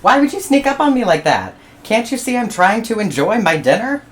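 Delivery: loud, high, fast scolding; indignant platypus. Acted. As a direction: snap at the intruder.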